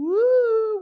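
drawn-out high, loud "woo"; long rising woo. Pretend. Preacher teasing the what-ifs, long high woo. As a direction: howl a long, swelling woo. Spooky sound after what if.

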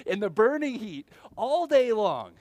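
loud, high aggrieved complaint; indignant protest. Retold or imitated. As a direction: hammer the unfairness home. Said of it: "They're like".

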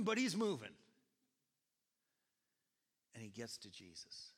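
ordinary talk, nothing marked

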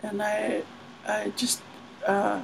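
whisper-soft nervous declaration; timid suitor confessing softly; hushed confession. Retold or imitated. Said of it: "he's like".

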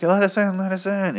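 high-pitched mock plea to get in; pleading crowd. Locked-out parishioners begging the priest to open up, high mock pleading.

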